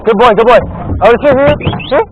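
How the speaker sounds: high, loud coaxing call; calling a dog. Real, not acted.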